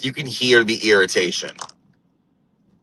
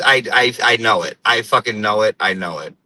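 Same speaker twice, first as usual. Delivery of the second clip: ordinary talk, nothing marked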